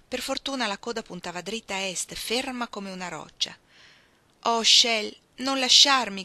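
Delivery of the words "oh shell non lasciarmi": loud, higher pleading cry; impassioned cry. A narrator's reading. Woman begging her lover not to leave, loud cry. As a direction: cry out in desperate appeal. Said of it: "gridò".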